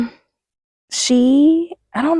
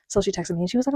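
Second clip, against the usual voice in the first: normal talking voice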